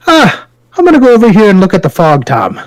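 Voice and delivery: hint of the Peaky Blinders character bowing out; Peaky Blinders quote. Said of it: "I stole this from the Peaky Blinders".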